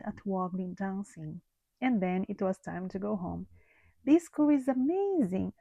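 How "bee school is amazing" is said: bright, higher happy exclamation; excited thought. A narrator's reading. Norman thrilled after his first day, excited. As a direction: Norman marvelling at bee school. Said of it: "thought Norman".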